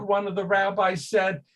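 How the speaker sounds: usual speaking voice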